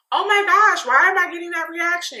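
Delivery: high-pitched fake innocent act; mock innocence. Vlogger mocking the star caught throwing jabs, fake innocent. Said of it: "when you're upset, be like".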